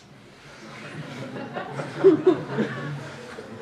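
long unvoiced burst of laughter; breathy laugh. Real, not acted. Preacher laughing at the pause app, loud and airy.

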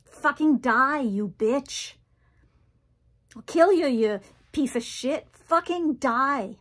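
high, loud screamed death threats; enraged shouting, slowing on the last curse; furious yelling. Acted. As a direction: yell it full out at the wasp. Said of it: "I started yelling".

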